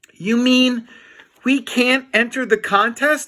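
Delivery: high, loud disbelieving question; dismayed. Acted. Harold shocked at being barred from the contest, high. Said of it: "asked Harold".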